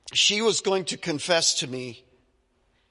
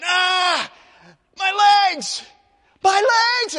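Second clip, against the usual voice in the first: shouted high, loud cry of pain; high shouted cry, my legs; retold screaming; 14 semitones higher, 7 dB louder, slower than usual. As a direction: scream it out in agony. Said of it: "he started screaming".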